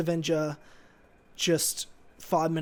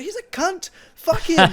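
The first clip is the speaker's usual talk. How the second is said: much higher, much louder mock outburst; mum cursing; 12 semitones higher, 10 dB louder, slower than usual. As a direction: ham up an angry mum swearing at Iron Man. Mum voiced cursing Iron Man.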